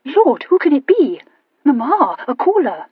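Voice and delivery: high, loud excited cry; eager girl calling to her mother; excited exclaiming. A narrator's reading. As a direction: burst out with news at the window. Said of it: "Charlotte exclaimed".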